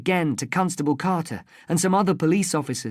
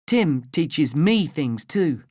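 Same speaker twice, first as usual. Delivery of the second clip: ordinary delivery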